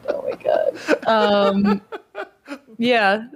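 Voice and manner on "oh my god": whispered. Real, not acted. Laughing disbelief.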